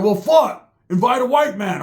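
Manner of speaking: up high and loud, indignant. Retold or imitated. Roach insisting on joining the fishing trip, loud and high. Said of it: "He's like".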